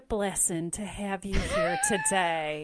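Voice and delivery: slow Southern Baptist church welcome. Pretend. Churchgoer welcoming the congregation, in a Southern Baptist accent. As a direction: greet the congregation in a Southern drawl. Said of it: "it's a slower Southern Baptist".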